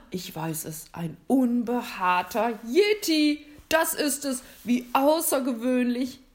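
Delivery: big Santa voice swinging with excitement; Santa, excitedly guessing. A narrator's reading. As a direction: build suspense, then burst out with the guess.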